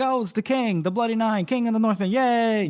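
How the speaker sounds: high-pitched, hammed-up crowd excitement; mock cheering. Gate guards greet their returning king, mock cheering. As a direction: cheer like an overexcited welcome party.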